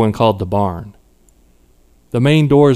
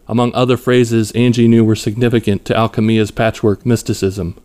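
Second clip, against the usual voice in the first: plain reading voice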